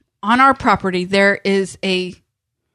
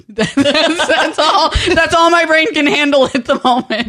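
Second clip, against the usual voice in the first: talking through laughter, higher and louder; laughing; 7 semitones higher, 5 dB louder than usual. Real, not acted. Laughing to a co-host about an overloaded brain, amused. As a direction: laugh your way through the words.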